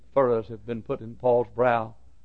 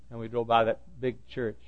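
normal talking voice

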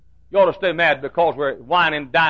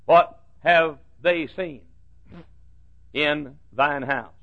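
slow scripture reading; slower than usual. Shown as a demonstration. Quotes scripture slowly.

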